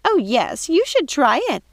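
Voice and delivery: high, gushing sister voice with cake; sister's voice. Acted. Lucinda urges Mrs Tittlebottom to try the cake. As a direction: gush it up high and eager.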